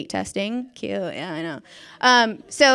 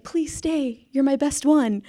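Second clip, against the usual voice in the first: lightly hammed-up begging, pitched higher; mock entreaty, a touch higher; imagined pleading; 4 semitones higher than usual. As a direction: plead as a principal losing a favorite. Imagined principal begging her to stay.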